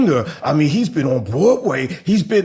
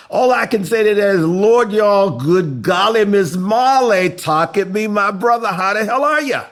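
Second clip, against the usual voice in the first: hammed-up welcome; 6 semitones higher, 3 dB louder than usual.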